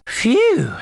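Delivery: high, exaggerated phew; hammed-up sigh of relief at the heat. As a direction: let out a big high-pitched phew. Feigning relief at a hot day.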